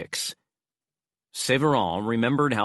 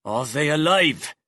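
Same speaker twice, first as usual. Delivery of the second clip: louder, pressing demand; insistent; 4 semitones higher, 3 dB louder than usual. Acted. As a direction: repeat it with force. Severin repeats, insisting.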